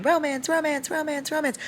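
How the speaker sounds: high, soft, chirpy chant; high singsong mimicry of the book; mimicking the book. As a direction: repeat it lightly in a high, soft little voice. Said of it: "the book literally was going".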